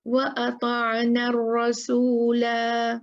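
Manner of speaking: slow, drawn-out tajweed recitation; stopping at the end of the verse, recited; Quranic recitation. Shown as a demonstration. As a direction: recite it slowly, stretching the vowels. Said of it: "ठीक है।".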